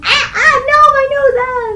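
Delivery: high, loud mock yelp through the nose; a hammed-up squeal of alarm; mock panicked cry. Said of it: "so I'm going".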